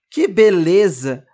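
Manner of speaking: slow, sneering mock praise; sarcastic, drawn-out compliment. A narrator's reading. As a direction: drag it out to mock him. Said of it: "debocha a boneca Fafá".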